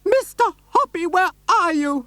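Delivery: a high lady shouting up from below; excited high-voiced neighbour calling; shrill excited lady. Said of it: "she was shouting".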